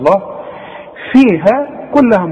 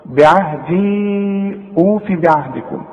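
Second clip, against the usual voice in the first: slow, measured tajweed recitation; Quran recitation; 3 dB quieter, slower than usual. Shown as a demonstration. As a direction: recite it slowly with long vowels. Recites verse.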